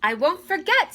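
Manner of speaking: high eager Cinderella. A narrator's reading. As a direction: promise it brightly, high. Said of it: "said Cinderella".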